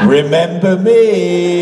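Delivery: slow, sing-song, drawn-out mock greeting; gloating taunt. Death laughing at Dean slipping on a banana, gloatingly. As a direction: stretch it out as a teasing gloat.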